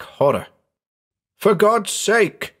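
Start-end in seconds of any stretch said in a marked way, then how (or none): 1.4-2.5 high, drawn-out horrified plea; urgent plea. A narrator's reading. Stricken man begging his friend for help, urgent plea. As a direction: plead slowly, pitched well up. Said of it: "he whispered".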